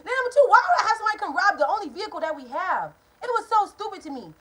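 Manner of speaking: loud, high outraged rant; angry ranting. Real, not acted. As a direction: rant in disbelief. Ranting at mother.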